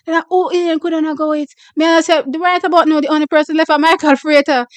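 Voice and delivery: chatty Jamaican patois storytelling. Real, not acted. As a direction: slip into Jamaican patois with friends.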